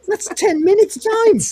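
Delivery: high, loud mock panic; alarmed exclamation. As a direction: blurt it out in shocked protest. Acting out shock at the deadline.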